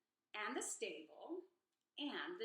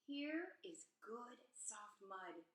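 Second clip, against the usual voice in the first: slow, softer blissful sigh of words; contented; 6 dB quieter, slower than usual. A narrator's reading. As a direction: savor every word. Pig delighted in the swamp.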